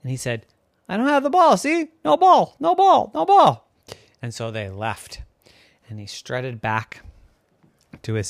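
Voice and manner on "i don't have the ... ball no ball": high, loud no ball; insistent denial. Acted. Boy telling attacking seagulls he has no ball, loud. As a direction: shout it to shoo the birds off. Diego fending off attacking gulls.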